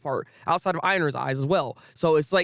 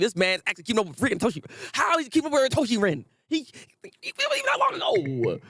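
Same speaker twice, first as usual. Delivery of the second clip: astonished rival; 5 semitones higher, faster than usual. Retold or imitated. Vlogger voices a stunned character whose voice shoots up high. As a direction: voice climbing high into a squeak. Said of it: "He's like".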